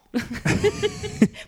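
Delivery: high, loud burst of laughter. Real, not acted. Co-host amused by the ukulele name, real high laugh. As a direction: burst out laughing at your own silly word. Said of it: "tú estás con los sonidos".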